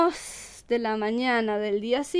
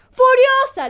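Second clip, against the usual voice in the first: high, loud burst on furiosa; furious shout; 12 semitones higher, 11 dB louder, slower than usual. Acted. Engracia telling the compadre how angry she is, shouting. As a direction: explode the word furiosa.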